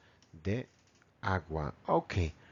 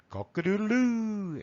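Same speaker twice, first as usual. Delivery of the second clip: rooster crow; 9 semitones higher than usual. Acted. Teacher showing children the English rooster call, crowing. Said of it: "el gallo dice".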